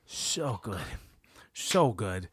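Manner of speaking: very low, soft savoring voice; creepy Harry. Retold or imitated. Friend acting Harry praising the pie, low savoring. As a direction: purr it out like a creep. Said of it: "And he goes".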